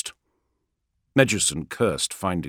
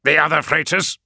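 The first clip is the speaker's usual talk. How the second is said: urgent question; 9 semitones higher, 6 dB louder than usual. Acted. Org asks urgently.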